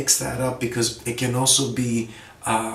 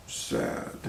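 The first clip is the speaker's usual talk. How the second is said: whispered sad; 10 dB quieter than usual. Shown as a demonstration. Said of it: "let's take it up a level".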